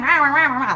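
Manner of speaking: high, loud gravelly rah rah; rasping rah rah, like this; raspy rah rah imitation. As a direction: mimic his raspy rasp. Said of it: "like this".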